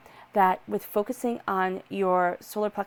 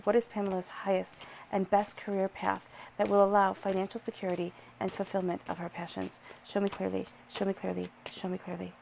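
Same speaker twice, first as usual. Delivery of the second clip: soft, fading invocation to the cards; whispered-down prayer; 5 dB quieter than usual. Acted. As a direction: ask the spirits softly, like a prayer. Asks the cards for guidance.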